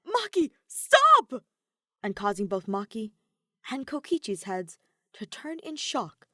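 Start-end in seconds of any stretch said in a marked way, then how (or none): 0.1-1.4 much higher, louder, slow desperate cry; robot shouting to halt a killing blow. A narrator's reading. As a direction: yell to stop her before it's too late. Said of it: "shouting something".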